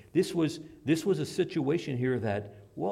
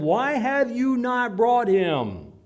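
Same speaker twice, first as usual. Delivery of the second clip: loud, slow, demanding question; demanding priests; 9 semitones higher, 9 dB louder, much slower than usual. Acted. Chief priests demanding an answer from the guards, loud and slow. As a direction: press the guards hard for an answer.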